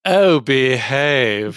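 drawn-out, hammy British catchphrase; put-on British movie-spy voice; Austin Powers. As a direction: stretch it out like a cheeky comic British swinger. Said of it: "I'm not having Austin Powers".

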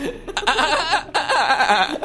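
high, unhinged creepy giggle. Acted. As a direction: giggle like a deranged clown. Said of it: "hearing this giggling".

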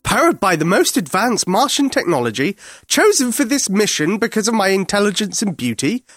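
high, indignant Martian rant; bad-tempered alien. A narrator's reading. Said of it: "clearly in a bad temper".

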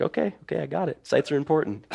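small, high, hushed voice; softly imitated cowed trainee; mock meek reply. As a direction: shrink back as if chastened. Acts cowed reply to coach.

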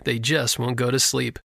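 usual speaking voice

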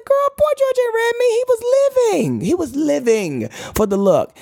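very high, gushing protest; emphatic denial. Retold or imitated. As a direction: insist he adored you, voice soaring. Defending idol to drag daughter.

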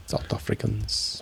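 South Africans in a put-on South African accent. Shown as a demonstration. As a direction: clip the vowels like a South African. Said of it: "Do you do your South African voice?".

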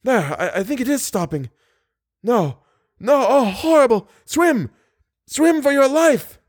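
high, frightened, voice climbing in terror; cling to hope, then break into panic; rising panic. Acted. Trapped man urging his companion as water rises, terrified.

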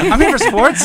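high, loud jock voice; mock dumb jock. Neighbor justifying loud subwoofer, high and loud jock voice. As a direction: play the meathead athlete. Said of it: "you're an athlete".

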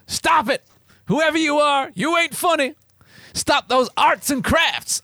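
high-pitched comic yell as the husband; hammed-up yelling. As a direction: overact an indignant high yell. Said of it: "my husband yells".

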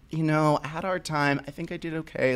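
mild imitation of a timid student; timid quiet reply. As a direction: shrink down and answer the teacher softly. Girl timidly assessing herself.

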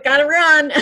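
hurried. Retold or imitated. Imitating fleeing man.

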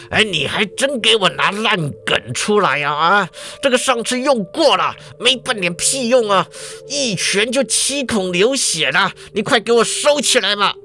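a director snapping at the professor; angry director. A narrator's reading. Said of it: "就生气啦".

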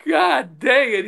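high, slow exasperated grumble, lightly imitated; imitating a beaten teammate, half-hearted; mock frustrated groan. Sparky reacts after being beaten.

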